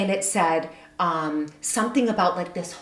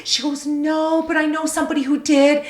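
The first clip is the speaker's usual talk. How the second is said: excited; 8 semitones higher, 7 dB louder than usual. Retold or imitated. Quoting herself and Kim on the phone.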